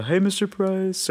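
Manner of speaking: put-on high, slow, timid teen hello; timid greeting. Teen greeting his friend's dad, high and timid. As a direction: greet your friend's dad shyly and carefully. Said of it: "I'm just like".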